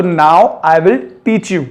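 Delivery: spoken very slowly, word by word; slow it right down for learners; very slow demo. Shown as a demonstration. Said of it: "teacher models the sentence". (बहुत धीरे, एक-एक शब्द)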